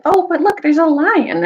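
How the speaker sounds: put-on high, bright-eyed girlish exclaim; excited girl. Gwendolyn at the window spotting the lion, high excited call. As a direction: point out the lion in delight. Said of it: "she was like".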